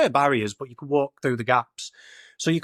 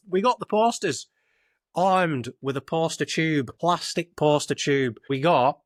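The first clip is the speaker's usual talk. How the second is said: ordinary talk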